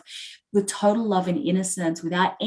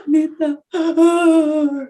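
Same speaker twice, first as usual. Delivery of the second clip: high, loud mock sobbing; acted-out crying, pitched way up; imitating dad bawling; 9 semitones higher, 7 dB louder, slower than usual. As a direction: gasp and wail out a tearful apology. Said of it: "And he's crying, and he's like".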